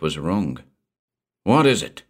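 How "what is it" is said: sharp urgent policeman snap; snapped. A narrator's reading. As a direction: bark the question, anxious. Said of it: "he snapped".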